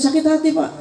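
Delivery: ordinary talk, nothing marked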